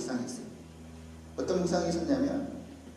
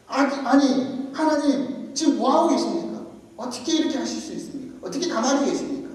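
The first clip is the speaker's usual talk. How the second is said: higher-pitched acted protest to God; resentful complaint; 7 semitones higher than usual. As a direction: demand answers, like an aggrieved believer. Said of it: "오히려 원망하고". (원망하듯 높은 소리로 따지기)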